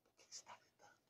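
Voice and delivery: whispered and eerie. Retold or imitated. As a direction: whisper it back to scare them. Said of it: "é assustador isso pra eles". (sussurro assustador)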